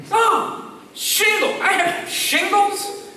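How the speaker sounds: high, drawn-out mock astonishment; stunned disbelief. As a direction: act out slowly taking in bad news. Retold shock at diagnosis.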